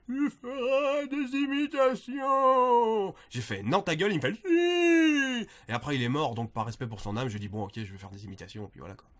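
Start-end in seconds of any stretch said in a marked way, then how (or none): 0.1-3.1 put-on high, slow, loud prophetic old man. Old man in a cave foretelling a destiny, high drawn-out cry. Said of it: "il y a un vieux qui m'a dit". 3.6-4.1 higher, louder mock refusal; acted loud retort. Retelling his reply to the cave hermit, loud retort. 4.3-5.5 very high, loud insistent cry; old man. Retold or imitated. Old man in a cave insisting, very high loud cry. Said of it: "il y a un vieux qui m'a dit".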